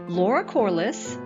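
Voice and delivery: normal talking voice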